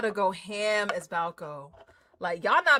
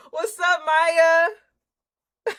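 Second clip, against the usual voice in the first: excited greeting; 8 semitones higher, 11 dB louder, much slower than usual. Real, not acted. Laughing greeting a viewer.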